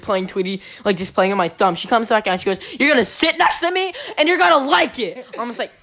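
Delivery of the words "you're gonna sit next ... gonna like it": higher-pitched scolding imitation; mock stern teacher. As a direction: lay down the law as the teacher, half laughing. Teacher's order in retold quarrel.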